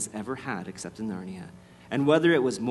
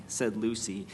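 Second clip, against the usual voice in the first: usual speaking voice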